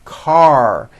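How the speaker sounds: "Car" closed firmly on a held R; strong final R. Shown as a demonstration. Teacher shows tongue pulled back, "Car" with strong final R. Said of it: "Your tongue has to pull back".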